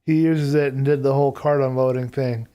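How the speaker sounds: ordinary talk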